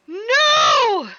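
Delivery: high loud disbelieving no; shocked shout. Real, not acted. Shocked by game reveal.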